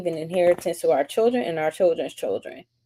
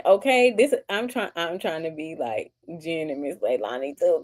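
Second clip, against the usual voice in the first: ordinary talk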